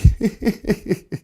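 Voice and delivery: high breathy giggle; quick laugh at himself; amused laugh. Real, not acted. Laughs at his rolling struggle.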